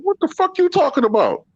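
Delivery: high-pitched retold indignation; imitating an incensed man in a high voice; high mock outrage. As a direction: play the baffled, angry victim way up high. Retold prank victim answers angrily.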